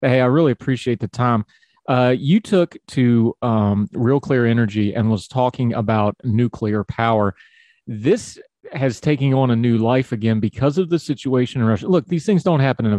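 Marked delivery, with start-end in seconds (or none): none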